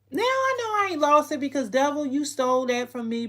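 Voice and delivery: high, loud declaration of faith; defiant rebuke. Real, not acted. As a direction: call the devil out like a preacher. Breaks off to rebuke the devil.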